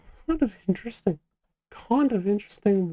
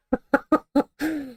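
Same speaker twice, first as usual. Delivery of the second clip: laugh; 6 semitones higher than usual. Real, not acted. Laughing at the news.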